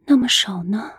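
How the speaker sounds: faint, frail whisper; barely voiced, too weak to speak. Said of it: "虚弱地再次追问".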